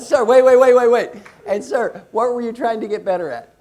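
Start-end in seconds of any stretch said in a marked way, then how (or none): 0.2-1.1 loud, high repeated call; calling a halt. Real, not acted. Coach halts the cheering to question the winner, loud calling out. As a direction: stop the room over its applause. Calls over noisy applauding room.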